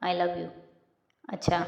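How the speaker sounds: usual speaking voice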